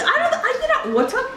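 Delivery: high laughing voice. Real, not acted. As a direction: laugh through the words. Laughs at the shiny find.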